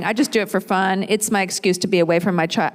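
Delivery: normal talking voice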